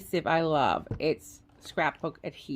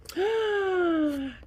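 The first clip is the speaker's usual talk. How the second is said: sharp delighted gasp, voice jumping up; excited high gasp; 11 semitones higher than usual. Real, not acted. Unboxing her Christmas gift, a high delighted gasp. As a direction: catch your breath in delight.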